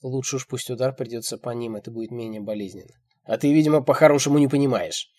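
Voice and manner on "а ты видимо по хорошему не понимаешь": loud, raised, caustic tone; biting sarcasm. A narrator's reading. Old man turns on her, caustic. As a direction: needle her with contempt. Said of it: "начинает он язвительным тоном".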